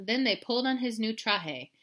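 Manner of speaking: plain reading voice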